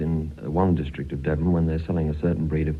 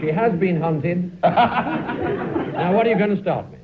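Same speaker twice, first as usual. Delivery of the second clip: laughing auctioneer selling a filly, pitched high; auctioneer patter; 9 semitones higher than usual. Pretend. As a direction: play the jolly horse-sale auctioneer, giggling through it. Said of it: "David, I'll tell you what we'll do. We'll make her a horse".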